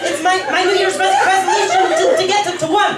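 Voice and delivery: high, laughing and stammering. Real, not acted. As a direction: laugh while struggling out words. Stuttering through laughing countdown.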